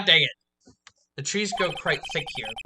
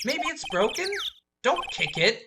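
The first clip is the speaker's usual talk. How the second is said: ordinary talk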